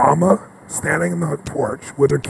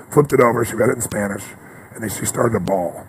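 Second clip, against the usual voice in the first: normal talking voice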